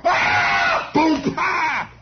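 mock cries around a vocal thud; yelp, boom, high yelp; knave getting clobbered. Shown as a demonstration. Comedian playing a knave hit by a mace, mock death cries. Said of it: "You want me to play a knave?".

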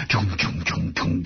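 tire thump noise. Retold or imitated. Imitating tunnel road bumps.

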